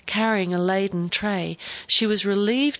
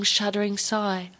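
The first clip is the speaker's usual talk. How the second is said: ordinary delivery, nothing marked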